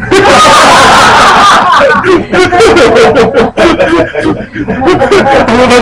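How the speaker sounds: hearty laugh at own punchline; loud burst of laughter. Real, not acted. Teacher cracking up at her own donkey joke, loud laughter.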